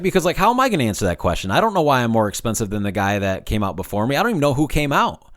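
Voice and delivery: usual speaking voice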